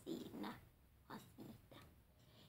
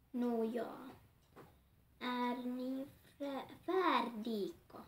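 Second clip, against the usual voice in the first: slow reading; 5 dB louder, much slower than usual. Acted. Reads dialogue slowly.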